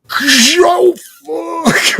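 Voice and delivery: high, drawn-out cry of disbelief; acted shock. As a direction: wail it like a stunned moviegoer. Acting out shock at movie scene.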